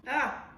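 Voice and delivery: sudden sharp cry of fright; startled yelp. Real, not acted. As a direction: yelp at a bug. Startled by a bug.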